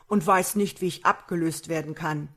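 a hint of a storybook giant; giant's voice. A narrator's reading. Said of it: "sagte der Riese". (leicht angedeutete Riesenstimme)

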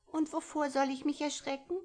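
put-on high, bright little-girl voice, curious. A narrator's reading. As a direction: ask like a small curious child, pitched high. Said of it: "Ein kleines Mädchen".

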